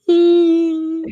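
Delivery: high, loud ghostly wail; eerie moan. Retold or imitated.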